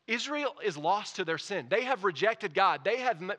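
high, loud preaching at full force; impassioned preaching. Real, not acted. Preacher telling church God stands in the gap, impassioned. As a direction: build and drive it home to the congregation.

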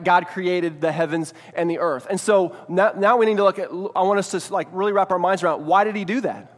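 normal talking voice

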